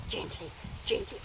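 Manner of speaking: breathy rising cue. Shown as a demonstration. Trainer teasing the dog before shouting, whispered. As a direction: tease with a whispered gently. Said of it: "I'm gonna shout again".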